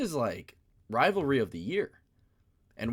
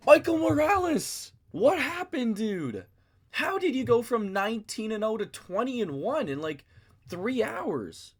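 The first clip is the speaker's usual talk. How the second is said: much higher and louder, an incredulous cry of what happened; mock disbelief; 11 semitones higher, 6 dB louder, slower than usual. A fan calling out to Michael Morales in mock disbelief.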